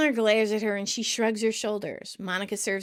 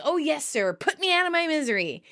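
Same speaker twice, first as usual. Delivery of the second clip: high-pitched eager flattery; Chandler sucking up; 8 semitones higher than usual. Retold or imitated. Chandler flattering his boss Doug, eager and fake. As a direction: imitate a toady humoring his boss, voice rising. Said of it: "Chandler fake laughs and says".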